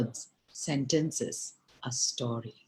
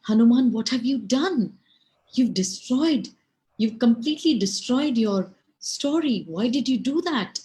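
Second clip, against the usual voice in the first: loud, high-pitched dismay; shocked; 8 semitones higher, 6 dB louder than usual. A narrator's reading. Valmiki watching Hanuman destroy his own story, shocked. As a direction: cry out in appalled disbelief. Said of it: "he's shocked, and he says".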